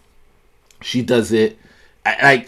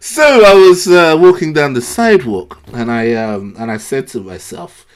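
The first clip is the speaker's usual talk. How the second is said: hammed-up American storyteller voice, pitched higher; put-on American accent; 6 semitones higher, 4 dB louder than usual. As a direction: play at being American, lifting the key phrases.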